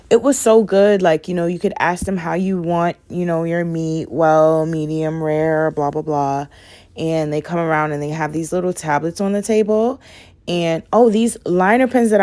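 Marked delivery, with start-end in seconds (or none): none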